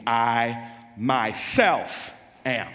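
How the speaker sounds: "myself" drawn out slowly and punched; stress on MYSELF. Shown as a demonstration. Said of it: "ego eimi means".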